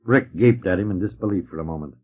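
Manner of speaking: plain reading voice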